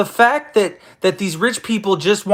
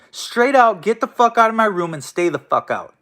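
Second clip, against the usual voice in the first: ordinary talk, nothing marked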